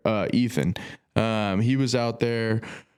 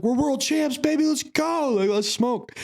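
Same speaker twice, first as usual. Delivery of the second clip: celebrating; 13 semitones higher than usual. Retold or imitated. Celebrating the knockout with Schmitty after the fight. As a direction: yell it like you just won the title. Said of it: "I was like".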